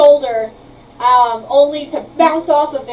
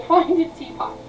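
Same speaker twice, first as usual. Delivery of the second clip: ordinary delivery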